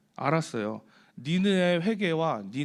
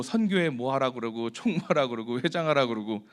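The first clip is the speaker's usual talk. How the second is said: ordinary talk, nothing marked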